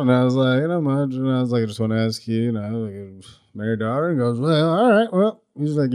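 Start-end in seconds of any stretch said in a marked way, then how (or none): 0.6-4.1 mumbled phone request, trailing softer; retold mumble. Asking her dad on the phone for permission, mumbled. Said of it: "Do you both mumble like that". 4.4-5.4 higher-pitched mumbled phone voice; mumbling father-in-law. Retold or imitated. Her dad answering on the phone, mumbled. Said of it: "Do you both mumble like that".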